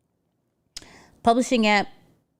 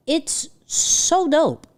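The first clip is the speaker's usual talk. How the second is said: high, slow, excited praise; so hyped; 7 semitones higher, much slower than usual. Real, not acted. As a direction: gush about it.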